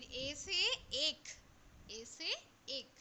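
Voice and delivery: put-on slow, clear letter drill for children; slow classroom recitation; slow teaching drill. Shown as a demonstration. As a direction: spell it out slowly for little learners. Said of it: "teacher drilling letters slowly".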